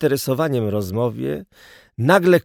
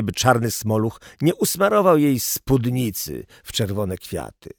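ordinary delivery

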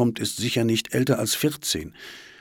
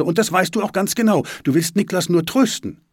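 raised, higher accusing voice; angry; 9 semitones higher, 5 dB louder than usual. A narrator's reading. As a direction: accuse him crossly. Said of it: "sagt Svenja böse". (böse, vorwurfsvoll)

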